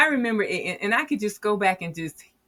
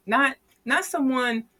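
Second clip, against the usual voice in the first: ordinary talk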